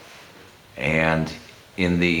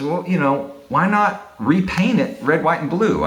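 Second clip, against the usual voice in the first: imitating a friend's game suggestion, pitched up; gamely; 8 semitones higher than usual. Friend suggesting a paint job for the ceiling, gamely. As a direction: float the idea gamely in a higher voice. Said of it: "very gamely".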